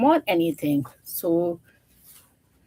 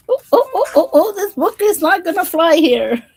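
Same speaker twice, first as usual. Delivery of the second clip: high, loud flustered cry; real frustration with the book, voice up; exasperated outburst; 10 semitones higher, 7 dB louder than usual. Real, not acted. Book pages slipping, exasperated.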